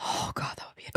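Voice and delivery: hushed whisper; breathy, unvoiced whisper. Real, not acted. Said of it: "whispering aside".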